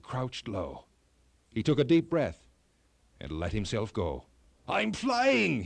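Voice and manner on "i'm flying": much higher, louder thrilled cry; cried out. A narrator's reading. King Brinna sliding down the mountain, crying out in thrilled delight. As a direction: shout it out with delight while flying. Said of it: "he cried".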